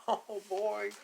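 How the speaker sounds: ordinary delivery, nothing marked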